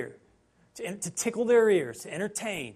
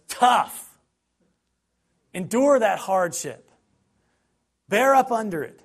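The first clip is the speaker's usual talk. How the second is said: firm, louder retelling of Paul; blunt Paul quote; 3 semitones higher, 7 dB louder than usual. Retold or imitated. Preacher voicing Paul's hard command, firm and loud. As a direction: bark it out like a tough mentor.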